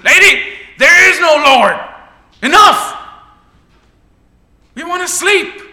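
high, loud, fed-up shouting; angry yell. Acted. Sleepless atheist neighbour yells at the old lady, furious. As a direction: bark it at her across the porch.

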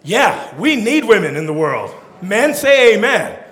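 ordinary delivery

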